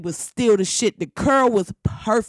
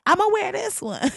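put-on much higher, airy girly quote; mock girlish voice; 11 semitones higher than usual. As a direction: pipe up like a little girl. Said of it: "And they was like".

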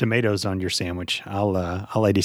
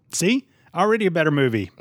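triumphant; 6 semitones higher than usual. Real, not acted. Triumphant self-praise.

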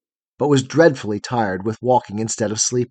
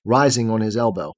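ordinary delivery

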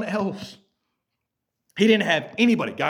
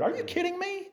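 incredulous; 6 dB quieter than usual. Real, not acted. Incredulous at calling it weird.

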